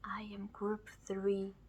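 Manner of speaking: soft, secretive whisper; hushed playful whisper; whisper in ear. Acted. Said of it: "that whisper in your ear".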